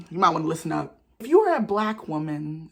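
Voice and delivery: usual speaking voice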